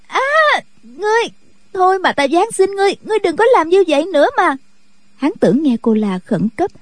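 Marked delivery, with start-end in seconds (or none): 0.1-4.6 put-on high, fast pleading of a terrified girl; frightened scream. A narrator's reading. Girl begging him to stop, high panicked cry. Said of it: "thét lên".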